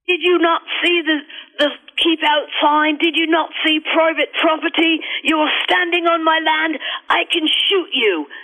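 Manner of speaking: high-pitched mock yell at a trespasser, English farmer; angry farmer yelling. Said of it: "The man is yelling".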